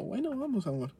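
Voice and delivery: high-pitched, soft easy yes; agreeable reply. Retold or imitated. Boyfriend accepting girlfriend's invitation to Cosechas, agreeable. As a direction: pitch it up, sweetly going along.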